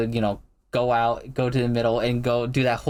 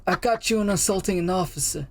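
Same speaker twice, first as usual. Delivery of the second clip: higher, strained impression of a police captain; captain's smoker voice; 7 semitones higher, faster than usual. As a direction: imitate a captain rasping on his last breath. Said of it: "He definitely has the smoker voice".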